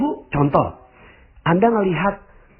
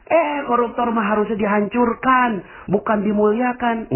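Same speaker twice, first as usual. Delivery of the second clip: objecting listener; 11 semitones higher than usual. Pretend.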